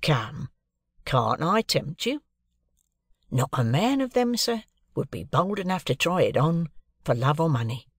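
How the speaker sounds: a lightly voiced city clerk; Wemmick voiced. A narrator's reading. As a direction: play the dry, proud clerk. Wemmick dialogue voiced by reader.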